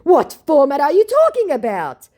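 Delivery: high, loud huffy question; exaggerated scammer outrage; mock exclaiming scammer. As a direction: read it like it's full of exclamation points. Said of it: "with an insane amount of exclamation points".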